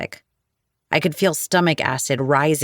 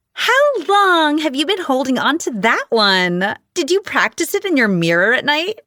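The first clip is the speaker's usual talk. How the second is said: high whiny mocking girl voice; whiny falsetto; 9 semitones higher, 4 dB louder than usual. A narrator's reading. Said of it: "Her whiny falsetto voice".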